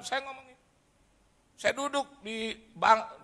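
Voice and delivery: ordinary delivery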